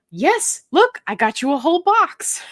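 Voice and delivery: bright, pushed-up eager reply; eager offer. Retold or imitated. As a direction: hand her the box with gusto. Answering wife, offering the chips.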